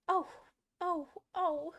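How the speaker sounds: high, loud wailing "oh"s; pained screams. A narrator's reading. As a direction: cry out in anticipated pain. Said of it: "Her screams were so like".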